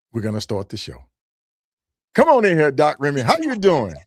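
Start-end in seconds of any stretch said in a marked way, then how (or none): 2.1-3.9 higher and louder, calling the guest in; podcast host greets guest, excited call; excited welcome. Real, not acted. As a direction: hype up the arriving guest. Host calls guest onto show.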